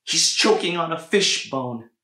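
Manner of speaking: high, loud desperate plea; frantic mother. A narrator's reading. Mother holding choking boy, frantic loud plea. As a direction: beg urgently for help. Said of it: "she cried".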